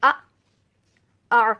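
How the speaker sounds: first آ a glottal jolt, second آ squeezed from the throat; hamza and ain. Shown as a demonstration. Teacher practising hamza and ain for students, loud. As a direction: contrast the two throat sounds. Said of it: "جیسے اس کو ہم practice کرتے ہیں".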